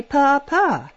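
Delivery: a hint of a baby trying a first word; baby talk. Cissy coaxing the baby to speak, light baby coo. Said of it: "she told him to say".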